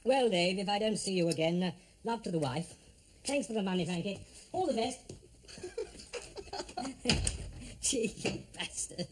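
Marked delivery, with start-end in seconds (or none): 5.5-7.8 laugh. Real, not acted. Laughs as his friend leaves.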